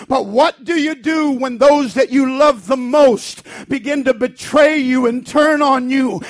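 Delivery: loud, fervent sermon delivery; preaching at full force. Acted. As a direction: preach it hard and passionate.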